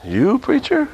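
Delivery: mock surprise. Congregant's imagined disbelieving question.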